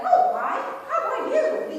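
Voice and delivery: high, loud copy of a movie line; movie-line imitation. Child repeating a movie line in a high loud voice. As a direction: parrot a line from a show. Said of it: "they're gonna be like".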